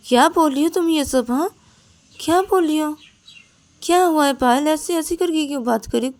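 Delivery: shocked by the rage, demand what's wrong; alarmed. Acted. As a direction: friend shaken by the strange outburst. Ananya shocked by outburst.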